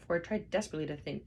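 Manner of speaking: ordinary delivery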